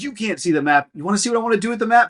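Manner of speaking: high, fast hammed-up anger; mock fury. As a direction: mom fed up, rising to a rant. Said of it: "she goes".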